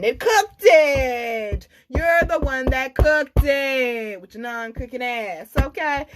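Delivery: mock outrage at a bad cook; exasperated. Real, not acted. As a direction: lean on it, incredulous. Mocking outrage.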